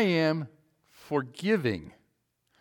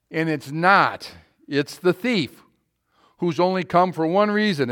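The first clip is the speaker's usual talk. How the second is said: loud, driving sermon voice; fiery preaching; 4 semitones higher, 8 dB louder than usual. Acted. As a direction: preach it hard to the congregation. Preaching at full force.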